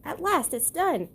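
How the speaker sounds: a hint of a pleased duck; lightly voiced cartoon duck; light Donald voice. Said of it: "said Donald Duck".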